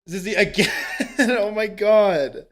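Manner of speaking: laughing disbelief. Real, not acted. Streamer laughing at a second big donation, laughing disbelief.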